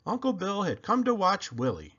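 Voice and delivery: ordinary delivery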